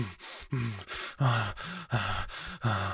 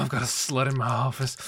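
ordinary talk

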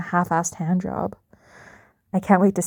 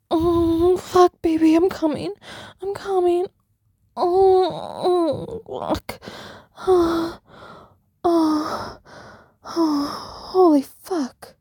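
climax; 8 semitones higher, much slower than usual. Acted. Climax moans.

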